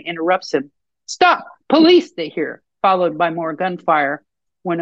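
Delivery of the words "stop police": sharp high police shout. A narrator's reading. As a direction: yell the warning from far down the hall. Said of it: "shouting from down the hallway".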